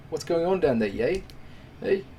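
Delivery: put-on a weak stab at a Canadian accent, complaining; bad Canadian accent. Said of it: "I can't do a Canadian accent".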